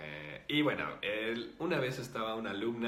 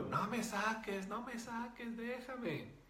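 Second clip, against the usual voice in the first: put-on little girl's yell; 14 semitones higher, slower than usual. Spirit begs the healer to let it be, mock little-girl yell. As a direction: play a pleading little girl, pitched way up and drawn out. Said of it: "Porque gritaba muy duro".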